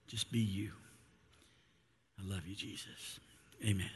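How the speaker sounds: earnest, softening spoken prayer. Acted. Pastor leads opening prayer.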